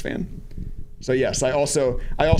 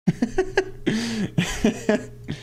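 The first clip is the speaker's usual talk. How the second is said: amused laugh; 9 semitones higher than usual. Real, not acted. Joke about telling his wife.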